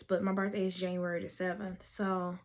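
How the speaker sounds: ordinary talk, nothing marked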